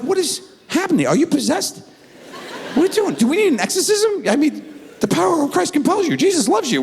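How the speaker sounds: high, hammed-up panicked dad; mock panic. Father fearing his daughter is possessed, mock panic. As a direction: blurt it out in comic alarm, pitch way up.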